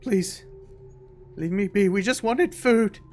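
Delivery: high, loud little-creature wail; pleading gnome. Acted. Gnome on its knees begging to be spared, high loud plea. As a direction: beg in a high, desperate squeal. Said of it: "It's like".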